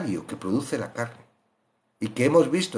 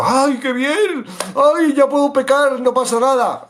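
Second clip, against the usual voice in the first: mock gleeful relief; 9 semitones higher, 6 dB louder than usual. Careless believer thinking he may sin freely, mock delighted. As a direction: ham up a careless sinner's glee.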